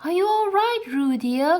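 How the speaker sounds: put-on a mother calling to her child in the water; high anxious calling out. A narrator's reading. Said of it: "called Kanga anxiously".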